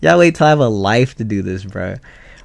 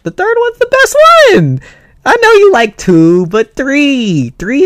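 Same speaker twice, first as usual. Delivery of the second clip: excited shout; 7 dB louder than usual. Real, not acted. Arguing about movies.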